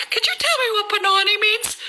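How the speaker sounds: high innocent voice asking a rude word; mock innocent question. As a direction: ask it sweetly, no idea what it means. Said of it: "And I'd be like".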